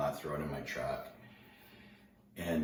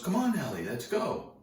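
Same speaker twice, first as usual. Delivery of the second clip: high, louder rallying call; retold eager call; 12 semitones higher, 5 dB louder than usual. Surfer urging reluctant teller into the water, high eager call. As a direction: imitate an eager friend calling you along.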